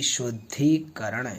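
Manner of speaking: शुद्धिकरण slow, with a drawn-out long "धी"; long dhi. Shown as a demonstration. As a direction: stretch शुद्धिकरण out syllable by syllable, low and soft. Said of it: "धी बड़ी आएगी".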